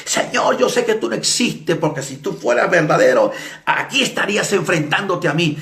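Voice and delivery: high, defiant cry to God; shouting. Retold or imitated. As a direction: imitate a man yelling his challenge.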